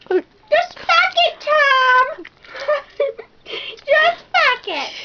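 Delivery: high slow giggly drawl; laughing. Real, not acted. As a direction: stretch it out giggling. Giggly drawn-out swear.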